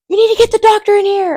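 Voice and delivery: imitating the nurse suddenly rushing; alarmed nurse. Nurse checking the patient, high alarmed imitation. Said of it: "She's like".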